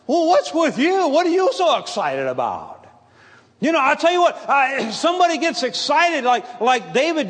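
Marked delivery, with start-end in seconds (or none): 0.1-2.8 high, loud startled question, then dropping; a mildly put-on church lady taken aback; shocked lady. Church lady shocked by an amen, mild startled voice. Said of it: "it shocked her".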